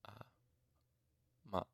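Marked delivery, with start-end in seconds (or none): none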